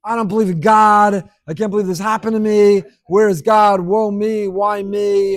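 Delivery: loud mock outburst of indignation; bent out of shape. Teacher mimics someone upset over pulling out the wrong coin, loud mock outrage. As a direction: wail it loudly, hammed-up woe-is-me complaint. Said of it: "got bent out of shape".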